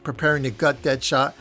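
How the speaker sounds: ordinary delivery, nothing marked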